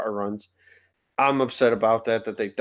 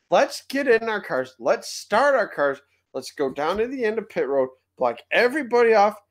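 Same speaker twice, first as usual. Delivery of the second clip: sarcastic rant; 7 semitones higher than usual. Acted. Mocking drivers' tactics in rant.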